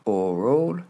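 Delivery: ORAL as "OR-ol"; first-syllable stress. Shown as a demonstration. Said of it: "je marque bien l'accent tonique sur la première syllabe".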